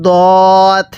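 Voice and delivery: দদ with one long rounded o, no wa glide; held, heavy model দদ; correct letter name. Shown as a demonstration. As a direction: stretch দদ as "dooo-d" on a level note. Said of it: "এরকমভাবে শিখব".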